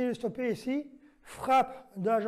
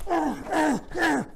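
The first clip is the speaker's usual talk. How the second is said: high, loud count on each blow; strike shouts; 6 semitones higher, 5 dB louder, much slower than usual. Acted. Instructor counting blows aloud in the self-defence demo, shouted high. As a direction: punch out every count with the strike.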